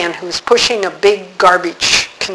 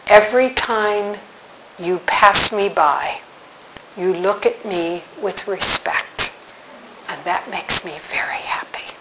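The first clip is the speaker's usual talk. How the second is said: quiet heartfelt; 6 dB quieter than usual. Retold or imitated. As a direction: tell it like a gentle secret. Man's quiet heartfelt words.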